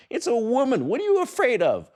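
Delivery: incredulous. Real, not acted. Incredulous high loud.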